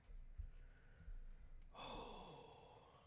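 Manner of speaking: slow breathy exhale of relief; long weary sigh. Real, not acted. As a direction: let out a long tired sigh. Long sigh after pain.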